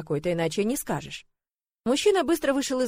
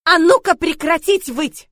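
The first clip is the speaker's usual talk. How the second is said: loud, high, slowed command; barked order; 7 semitones higher, 11 dB louder, slower than usual. A narrator's reading. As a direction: bark a drawn-out order to silence everyone. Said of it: "и рявкнула". (громко рявкнуть приказ)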